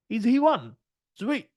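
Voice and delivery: sudden high burst of excitement; excited. Retold or imitated. Telling trading students how the chicken won, excited.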